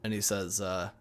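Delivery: ordinary talk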